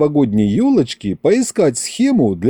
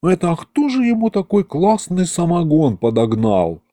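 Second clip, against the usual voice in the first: envious wonder; 5 semitones higher than usual. A narrator's reading. Skeleton Aristofan marvels at moonshine.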